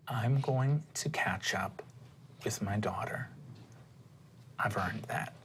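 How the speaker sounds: low, slow half-whisper; quiet, firm mother. Acted. Mother declining a job to be with her daughter, hushed. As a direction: say it hushed, leaving weighty pauses. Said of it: "Gabriella looks at you and says".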